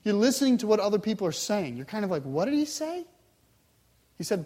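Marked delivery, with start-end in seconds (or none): 2.2-3.0 high, rising, puzzled question; puzzled listener. Acted. Follower too far back to hear Jesus, puzzled question. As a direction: ask your neighbor what you just missed.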